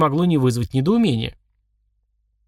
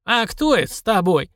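put-on old prospector; 7 semitones higher than usual. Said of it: "прищурился старик".

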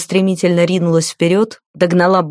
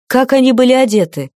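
higher-pitched urgent question; interrupting; 6 semitones higher than usual. A narrator's reading. Ильда interrupts the guard about the women's clothes, higher-pitched interruption. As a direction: cut in with a pressing question. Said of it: "перебила его Ильда".

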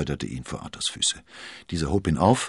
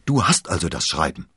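louder eager exclamation; called out; 7 dB louder than usual. A narrator's reading. D'Artagnan calling out to Athos about the letter. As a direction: burst out with it. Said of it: "rief D'Artagnan".

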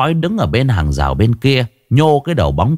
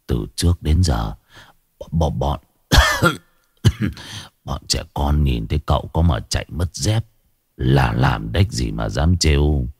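coughing sick man; 9 semitones lower, 4 dB quieter than usual. Acted. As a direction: talk through a bad cough. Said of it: "ho sù sụ". (người ốm ho sù sụ, giọng trầm yếu)

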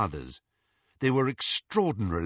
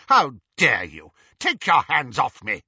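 loud, commanding outburst; furious order to let go; outraged; 3 semitones higher, 7 dB louder than usual. A narrator's reading. Bellatrix struggles in Lucius's grip.

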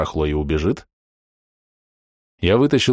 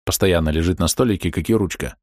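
ordinary delivery

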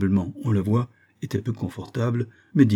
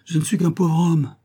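high, louder whining plea; pitiful voice; 9 semitones higher, 5 dB louder than usual. A narrator's reading. Miser whining to unwanted visitors, high and pitiful. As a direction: plead poverty pitifully. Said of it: "d'une voix piteuse".

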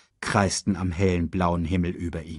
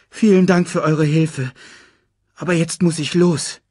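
higher, clearly projected cub voice; polite lion cub; 7 semitones higher, 6 dB louder than usual. Acted. Cub taking leave of his rescuers, higher polite voice. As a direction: thank them in a raised young voice.

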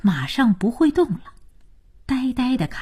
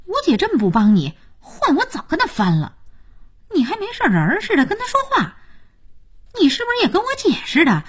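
rapid, high-pitched scolding; exasperated; 7 semitones higher, faster than usual. Acted. Jiayin scolds Liao Yu for tolerating her sister, exasperated. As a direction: berate him in a fast, rising outburst.

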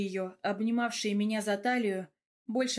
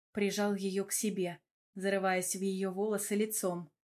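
ordinary delivery, nothing marked

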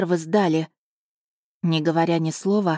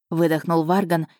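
plain reading voice

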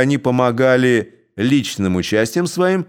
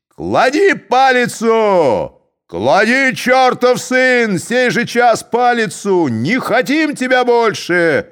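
loud, slow, high furious yelling; angry shout; 10 semitones higher, 3 dB louder, slower than usual. A narrator's reading. Drunk Cossacks shouting at the Koshevoi, furious. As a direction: bellow it at the chief like a drunken mob. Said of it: "кричали из толпы казаки".

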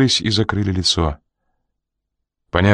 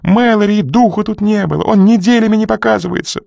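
истеричное отрицание; 13 semitones higher, 3 dB louder than usual. A narrator's reading.

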